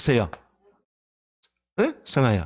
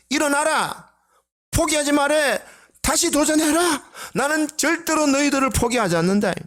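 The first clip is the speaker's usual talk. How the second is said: high, ringing preacher's proclamation in Jesus's voice; preaching as Jesus; 9 semitones higher than usual. Acted. Pastor voicing Jesus to the congregation, fervent high proclamation. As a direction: proclaim it like the risen Lord rallying his men.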